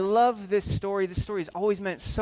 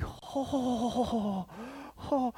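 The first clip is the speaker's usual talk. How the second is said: hushed shock; 5 semitones higher, 8 dB quieter than usual. Acted. Son stunned, trying not to laugh.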